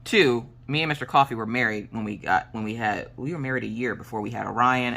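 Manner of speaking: normal talking voice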